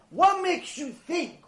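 high, drawn-out incredulous question; exasperated challenge. Acted. Adult challenges the boy.

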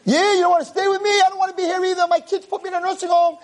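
high, relentless cranky tirade; old man yelling. Acted. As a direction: rant up high like a furious old grouch. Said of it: "and he's yelling".

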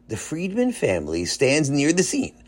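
plain reading voice

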